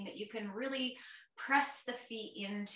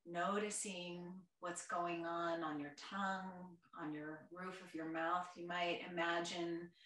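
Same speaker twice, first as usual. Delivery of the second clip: guided meditation; 3 semitones lower, 6 dB quieter than usual. Real, not acted. Yoga teacher guiding a chair class through their senses, soft meditation voice. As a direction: gently guide them inward, quieter and lower than chat.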